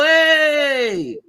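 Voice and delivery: normal talking voice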